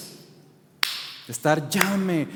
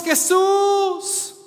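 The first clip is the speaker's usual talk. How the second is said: desperate shout; 12 semitones higher than usual. Retold or imitated. Blind beggar crying out to passing Jesus, desperate shout. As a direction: call out to Jesus over the crowd. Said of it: "siguió levantando su voz".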